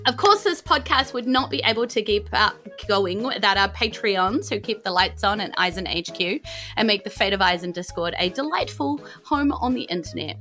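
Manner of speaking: normal talking voice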